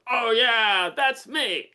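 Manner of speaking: loud, high-pitched burst of recognition; excited burly guy. Retold or imitated. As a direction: imitate a client exclaiming eagerly. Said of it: "this forty-year-old burly guy goes".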